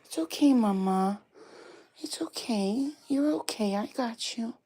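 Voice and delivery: high gentle reassurance to a pet. Real, not acted. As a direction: comfort the frightened dog up high. Soothing scared dog.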